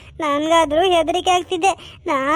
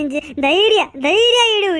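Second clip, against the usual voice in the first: ordinary delivery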